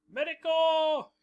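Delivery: drawn-out, high call for a medic; slow, stretched soldier's cry; man down shout. Retold or imitated. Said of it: "You know, like".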